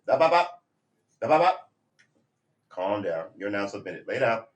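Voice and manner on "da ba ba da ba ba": playful sing-song. Real, not acted. Playful nonsense at puppy.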